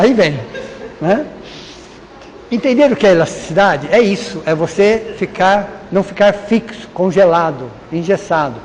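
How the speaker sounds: normal talking voice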